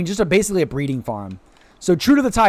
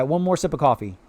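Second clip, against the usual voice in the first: ordinary talk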